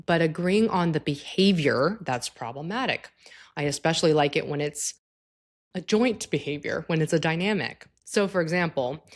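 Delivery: ordinary talk, nothing marked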